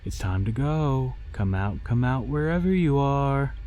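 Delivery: high playful sing-song coax; sing-songy coaxing. A narrator's reading. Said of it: "in a sing-songy tone".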